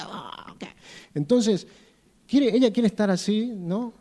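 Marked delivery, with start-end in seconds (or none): none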